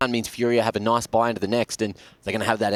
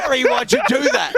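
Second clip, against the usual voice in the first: much higher, louder whiny accusation; mock accusing; 5 dB louder than usual. Teammate squeals the blame at Harry, high and loud. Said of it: "Why are you saying it like that".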